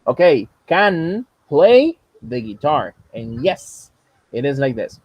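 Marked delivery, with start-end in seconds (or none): 0.7-2.9 drawn-out teacher demonstration; slow, louder classroom model; slow model phrase. Shown as a demonstration. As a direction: space the words out slowly for a learner. Teacher models student's sentence slowly.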